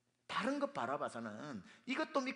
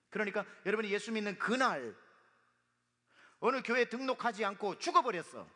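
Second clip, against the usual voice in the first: forceful raised preaching; rising pulpit fervor; preaching loudly; 6 semitones higher, 7 dB louder than usual. Real, not acted. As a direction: drive the point home loudly. Preacher raising voice for emphasis.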